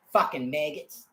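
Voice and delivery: normal talking voice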